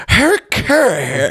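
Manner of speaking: high-pitched goofy announcer impression; hammed-up high announcer croon; Chicago Cubs announcer. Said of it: "it's the Chicago Cubs announcer".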